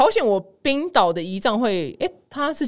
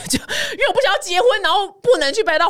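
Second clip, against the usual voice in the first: laughing; 12 semitones higher, 3 dB louder than usual. Real, not acted. Laughing at her own answer.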